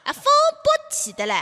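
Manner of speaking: put-on high-pitched playful Jiangzhe accent. Co-host continuing the mock recital, Jiangzhe accent. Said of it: "江浙地方的朋友来朗诵".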